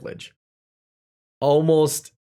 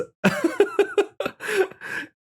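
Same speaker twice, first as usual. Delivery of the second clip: burst of laughter. Real, not acted. Laughs while remembering school day.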